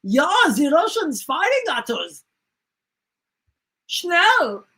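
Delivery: hammed-up German alarm, rising to a high "Schnell"; mock panicked German. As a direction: yelp it like a cartoon German pilot under fire. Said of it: "he's like".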